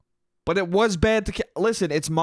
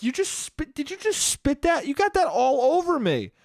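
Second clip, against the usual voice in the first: mock indignant friend; 9 semitones higher than usual. Friend finds tequila spat on her, high mock indignation.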